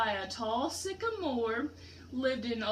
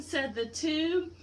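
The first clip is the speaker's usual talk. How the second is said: ordinary delivery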